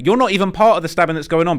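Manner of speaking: real heated objection; indignant rant. Real, not acted. Ranting at bystanders defending gang members.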